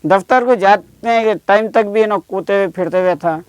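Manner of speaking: ordinary delivery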